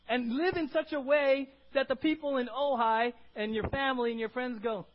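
high, loud, driving sermon voice; emphatic preaching. Real, not acted. Preaches at full force.

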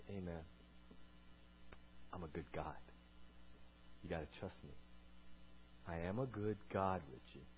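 soft reassuring God voice; gentle. Retold or imitated. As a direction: say it softly and kindly. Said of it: "God's like".

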